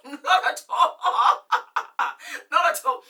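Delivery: real laughter breaking through the words; laughing. Real, not acted. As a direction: laugh it out, amused by your own point. Laughing at own point.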